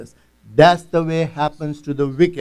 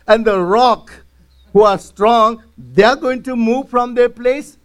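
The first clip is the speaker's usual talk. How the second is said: mock angry rebuke; 6 semitones higher than usual. Preacher voicing Bildad scolding Job, mock indignant and high. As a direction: retell the friend's rebuke, lightly heated. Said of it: "this man is little bit angry".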